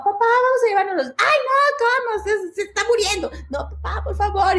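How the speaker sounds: imitando a una niña que suplica, más aguda; súplica infantil hacia papá; ruego de niña. Retold or imitated. Hijas ruegan al papá. (las hijas ruegan al papá, voz aguda de niña)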